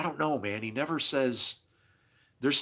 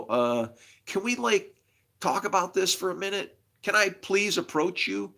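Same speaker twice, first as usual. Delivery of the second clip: higher-pitched, polite mock request; tentative plea; 7 semitones higher than usual. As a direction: gingerly ask leaders for a hearing. Acting a timid plea to leaders.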